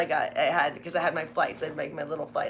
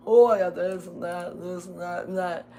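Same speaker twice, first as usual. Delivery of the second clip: mock whiny flyer; 3 dB louder than usual.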